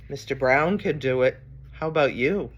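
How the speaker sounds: ordinary delivery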